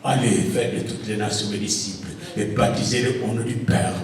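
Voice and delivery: ordinary talk, nothing marked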